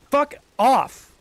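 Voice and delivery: sudden high yell after a gasp; startled rage shout; gasping furious outburst. Real, not acted. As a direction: blurt it out at the game. Gasp then outburst at game.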